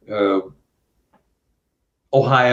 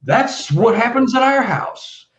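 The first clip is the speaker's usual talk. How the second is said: knowing witness; 13 semitones higher than usual. Retold or imitated. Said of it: "you only get two reactions".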